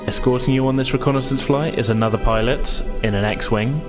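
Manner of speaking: normal talking voice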